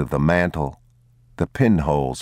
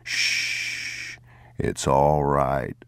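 whispered shush, then slow and soft; calm the crying child; father hushing his crying son; soothing hush; 3 semitones lower, 4 dB quieter, much slower than usual. A narrator's reading.

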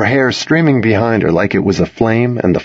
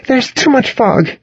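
much higher, pressing female character voice; insistent. A narrator's reading. Girl insisting on driving through fog, insistent. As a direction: press the point in a high voice. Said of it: "she insisted".

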